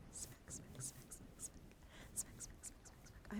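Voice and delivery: very soft, rhythmic whispered smacks; barely audible ASMR trigger words; whispered repetition. Pretend. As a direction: whisper each pat as a tiny sound. Whispered head-pat sounds for ASMR.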